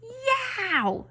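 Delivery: sudden very high yelp of pain; scream. A narrator's reading. Leslie shrieking as her pigtail is pulled. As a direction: shriek as your hair is yanked. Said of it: "screamed Leslie".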